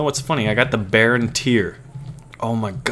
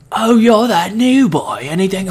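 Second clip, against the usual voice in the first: put-on scratchy villager accent; 8 semitones higher, 5 dB louder than usual. Gamer mimicking a village NPC, in a scratchy higher voice. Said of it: "my scratchy voice from doing these accents".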